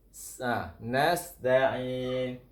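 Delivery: ordinary talk, nothing marked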